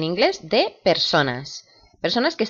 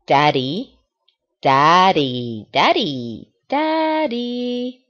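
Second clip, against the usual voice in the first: put-on child calling daddy; much slower than usual. Shown as a demonstration. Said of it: "dice daddy".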